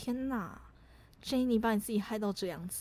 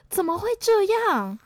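teacher's surprise; 10 semitones higher, 9 dB louder, slower than usual. Retold or imitated. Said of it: "然后他就说：".

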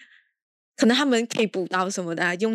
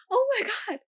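high-pitched gasp of disbelief; shocked; 13 semitones higher, slower than usual. Real, not acted. Reviewer reacting to the twist, high shocked exclamation. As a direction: squeal it in shock. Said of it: "我整个就".

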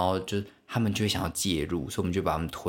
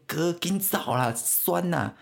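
put-on high, slow little-kid voice urging; a small boy begging big brother to run; little brother's plea; 11 semitones higher, slower than usual. Little brother telling him to run away, high child voice.

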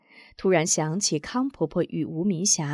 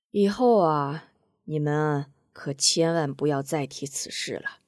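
put-on lower, softer resigned old woman; soft wistful laugh; 3 semitones lower than usual. A narrator's reading. Granny Kang to the children, softly resigned. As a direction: let it go gently. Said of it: "说着轻轻一笑".

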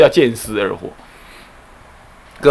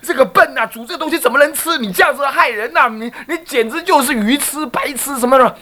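high, mock-furious tirade of an old monk; old abbot tearing into the nun, mock-furious; scolding tirade; 10 semitones higher than usual. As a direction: berate her like an enraged old master. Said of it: "就大骂特骂".

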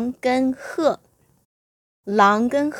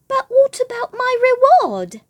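high, louder anxious crane call; 4 dB louder than usual. Acted. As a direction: call out anxiously, much higher. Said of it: "called the crane anxiously".

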